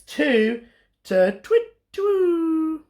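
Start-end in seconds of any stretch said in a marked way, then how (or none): 1.4-2.8 high hooting title; owl hoot. Acted. Reading the title to children as an owl call.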